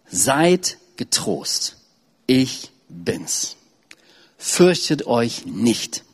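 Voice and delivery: very slow, gentle words of Jesus; solemn reading. Acted. Preacher reads Jesus calming the frightened disciples, slowly. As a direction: let each phrase settle, slowly. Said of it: "Jesus aber redete sogleich mit ihnen und sprach".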